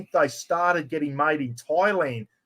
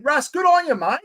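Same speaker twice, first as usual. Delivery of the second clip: excited congrats; 10 semitones higher than usual. Real, not acted. Congratulating the prize winner.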